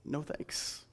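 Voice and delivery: breathy whispered no thanks; mock whispered refusal. First guy refusing help, in a hushed mock whisper. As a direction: turn him down in a half-whisper. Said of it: "shake his head and be like".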